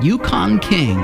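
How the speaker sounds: ordinary talk, nothing marked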